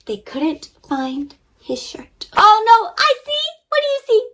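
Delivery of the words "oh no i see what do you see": high, bright-eyed storm excitement; excited storyteller. Acted. Storyteller thrilling kids with the storm, excited. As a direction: pitch way up as the storm hits. Storm scene told to children.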